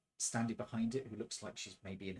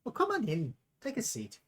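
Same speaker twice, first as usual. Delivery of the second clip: higher, brighter barmaid greeting; barmaid welcome; 9 semitones higher, 4 dB louder than usual. Acted. Barmaid welcoming the newcomer, pitched up. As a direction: welcome them in from behind the bar.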